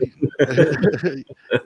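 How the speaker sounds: a real, easy laugh. Real, not acted. Laughing with his friend on the phone, real easy laugh. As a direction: laugh along freely.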